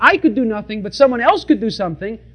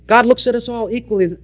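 normal talking voice

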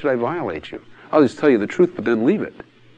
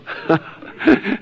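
high, amused burst of laughter; amused chuckle breaking out; hearty laugh; 9 semitones higher than usual. Shown as a demonstration. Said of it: "I always laugh when I hear".

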